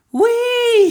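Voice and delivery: very high, loud squeal of joy; gleeful yell. A narrator's reading. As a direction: squeal like a thrilled toddler. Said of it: "he began to yell".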